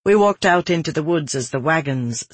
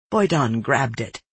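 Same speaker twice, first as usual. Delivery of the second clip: ordinary delivery, nothing marked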